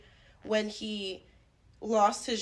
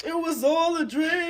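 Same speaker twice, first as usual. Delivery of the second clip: higher, hammed-up quote of a rap lyric; 6 semitones higher, 4 dB louder than usual. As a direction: belt out the famous line playfully. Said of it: "as [name] said".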